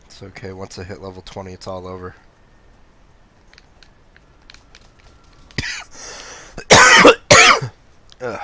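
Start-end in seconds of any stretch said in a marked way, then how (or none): 4.4-8.2 loud throat-clearing cough, bursting out; phlegm cough. Real, not acted. Streamer with phlegm tickling the throat, real loud cough. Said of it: "The phlegm. It's tickling my throat".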